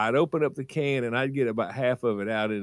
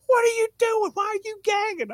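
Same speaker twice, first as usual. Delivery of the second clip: mama's shrill alarm; faster than usual. Retold or imitated. Mama finding her son gagging over dog food, very high voice. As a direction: shriek it like mama catching him. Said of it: "she goes".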